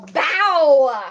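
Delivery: loud high reveal shout; excited shout. Real, not acted. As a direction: yell it out like a big reveal. Reveals shirt with countdown.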